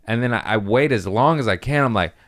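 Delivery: normal talking voice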